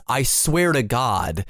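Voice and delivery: "swear" slow and stressed. Retold or imitated. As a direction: lean on "swear" in "I swear to God". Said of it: "emphasizing the wrong word".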